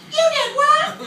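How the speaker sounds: very high shrieking mom disbelief; shocked mom. Retold or imitated. Mom hears about the park, high shocked shriek. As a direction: squeal it like a horrified mother.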